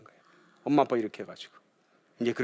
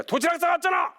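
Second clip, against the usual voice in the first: mother yelling. Retold or imitated. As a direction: yell back at the kid. Mother snapping back at child.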